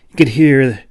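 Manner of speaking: ordinary delivery, nothing marked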